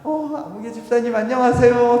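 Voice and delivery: a hint of a chirpy churchgoer greeting the deacon; cheery greeting. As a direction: switch into polite church mode. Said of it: "모드로 바뀝니다".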